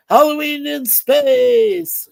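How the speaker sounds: high, loud, long-drawn title call; mock spooky announcer. Host imagining the horror game's theme, drawn-out mock announcer. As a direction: stretch it out like a hammed-up movie title.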